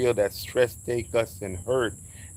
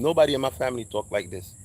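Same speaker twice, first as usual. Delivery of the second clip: normal talking voice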